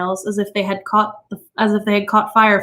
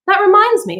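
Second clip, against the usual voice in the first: lightly voiced eager wife; 11 semitones higher, 5 dB louder than usual. A narrator's reading. Mildred changing subject.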